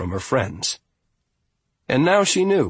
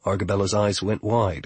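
ordinary delivery

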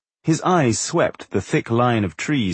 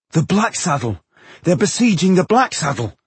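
higher, excited exclamation; sudden realisation; 8 semitones higher, 3 dB louder than usual. A narrator's reading. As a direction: blurt out the answer as it dawns. Hero suddenly solves the puzzle.

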